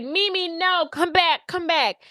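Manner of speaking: calling after. Acted. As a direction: call after a friend running away. Acted calling after fleeing friend.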